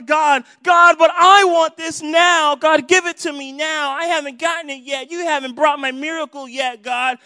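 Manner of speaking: loud, high mock tantrum at God; bratty demanding. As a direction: demand it like a spoiled child. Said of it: "that's how we are a lot of times".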